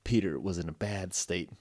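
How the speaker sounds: ordinary delivery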